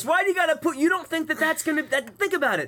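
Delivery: mock offended. Real, not acted. Mock-offended protest at friends.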